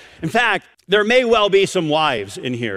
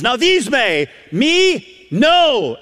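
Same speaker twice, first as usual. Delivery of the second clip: ME and NO high and set apart; raised pitch, hard hits on "me" and "no"; emphatic denial; 7 semitones higher, 4 dB louder, much slower than usual. Retold or imitated. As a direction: punch ME, then a high, firm NO. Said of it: "he says it in such an emphatic way".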